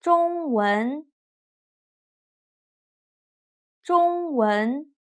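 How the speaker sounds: slow drill of "中文", level then rising; first plus second tone. Shown as a demonstration. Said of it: "first tone plus second tone".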